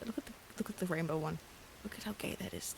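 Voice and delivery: ordinary delivery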